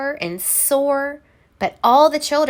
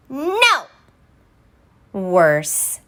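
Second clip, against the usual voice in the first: emphatic no. Acted. Narrator correcting herself emphatically.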